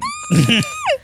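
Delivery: a squeaky high laugh. Real, not acted. Storyteller cracking up mid-story.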